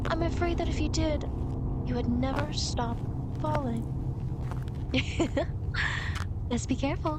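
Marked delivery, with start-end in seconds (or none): 3.9-6.5 airy laugh. Acted. Guide laughs after threat of falling.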